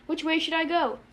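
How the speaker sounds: high, anguished question; understated furious cry; suffering high-pitched shout. A narrator's reading. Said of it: "high-pitched voice".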